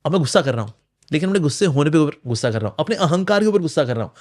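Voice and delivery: usual speaking voice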